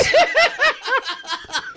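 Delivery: shrieking laughter; high-pitched laugh. Real, not acted. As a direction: squeal with laughter. Laughing fit.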